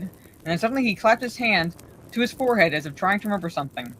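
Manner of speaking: ordinary delivery, nothing marked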